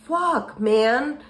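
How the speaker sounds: drawn-out, stunned exclamation; disbelief. Retold or imitated. Woman finding her car keyed, in stunned disbelief. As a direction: let it out slowly, incredulous. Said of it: "sitting there in disbelief".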